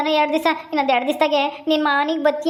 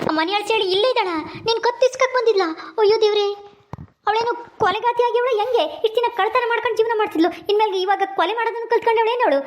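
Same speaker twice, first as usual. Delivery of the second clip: high, outraged disbelief; shocked and furious at the news, pitched high; 7 semitones higher than usual. Acted. Son outraged that the woman tried to kill his mother, high-pitched shock.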